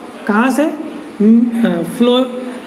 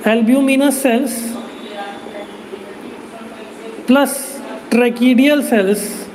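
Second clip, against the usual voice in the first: slow emphasis; slower than usual. Shown as a demonstration. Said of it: "दोनों को मिला करके".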